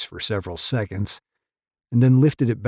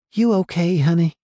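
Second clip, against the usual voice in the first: high, tender woman's voice; caring woman; 9 semitones higher, 6 dB louder than usual. A narrator's reading. Barbie comforting Jake.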